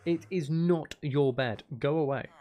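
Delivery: ordinary talk, nothing marked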